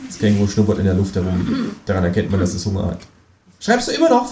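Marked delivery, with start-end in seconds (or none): 3.6-4.3 much higher, pert kangaroo voice; cheeky kangaroo voice. Acted. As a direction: play the kangaroo as a sassy, high-voiced know-it-all. Känguru dialogue.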